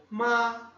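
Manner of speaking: "ma" held high and level; first tone. Shown as a demonstration. Teacher introducing the first tone, "ma" high and continuous. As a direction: sustain "ma" on one high note. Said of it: "Primeiro tom".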